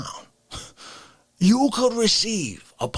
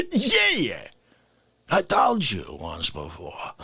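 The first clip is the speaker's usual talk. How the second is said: smug concert fan; 7 semitones lower, slower than usual. Retold or imitated. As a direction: play the fan who has heard it all. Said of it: "not been like".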